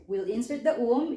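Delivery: ordinary talk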